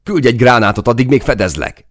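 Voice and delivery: loud, high battlefield shout; urgent yell. A narrator's reading. Soldier across to his comrade, urgent yell. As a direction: yell the order over gunfire. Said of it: "üvöltöttem át Máténak".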